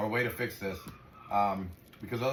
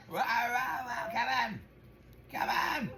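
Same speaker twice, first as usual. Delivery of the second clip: much higher, excited play voice to the dog; playful dog rouse; 11 semitones higher, much slower than usual. Real, not acted. Owner playing with puppy Claire, high excited rousing. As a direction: rile the puppy up, bouncy and high.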